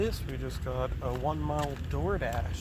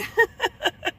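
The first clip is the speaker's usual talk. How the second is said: laugh; 6 dB louder than usual. Real, not acted. Laughing at the story.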